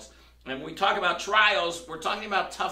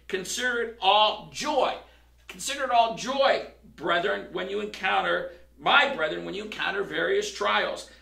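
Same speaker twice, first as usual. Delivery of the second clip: scripture reading; 3 semitones higher than usual. Shown as a demonstration. Reads scripture aloud.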